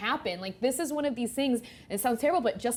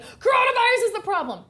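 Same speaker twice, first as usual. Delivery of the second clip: mocking others; 11 semitones higher, 6 dB louder than usual. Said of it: "they're like".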